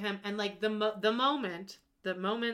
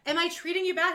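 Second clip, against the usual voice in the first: higher and louder, protesting; mock husband protest; 7 semitones higher, 6 dB louder than usual. Host mimicking the husband defending himself, loud confused protest. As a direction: act confused and wronged. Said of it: "he's like".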